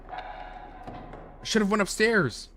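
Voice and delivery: high, slow whiny regret; hammed-up wail of regret; mock lament. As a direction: drag it out like a sulking child. Joke regret after ending.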